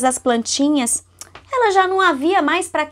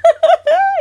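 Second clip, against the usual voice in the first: real laugh; 5 dB louder than usual. Real, not acted. Mother laughing at her child's funny remark, real laugh. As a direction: laugh out, amused and surprised.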